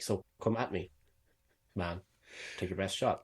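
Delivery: soft read, full stops after "me" and "man"; reading the punctuation. Shown as a demonstration. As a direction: leave "man" hanging alone between pauses. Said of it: "do the punctuation in places where it actually had it".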